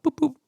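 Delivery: two short high-pitched horn boops; horn beeps. Retold or imitated. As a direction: imitate a little horn beeping twice. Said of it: "beeping the horn".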